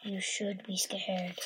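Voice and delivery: slow low spooky whisper; a kid's mock ghost threat; scary voice. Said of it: "Arlo said in a scary voice".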